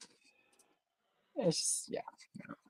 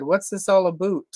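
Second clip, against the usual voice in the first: mock Canadian accent; 5 semitones higher, 4 dB louder than usual. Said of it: "literally said".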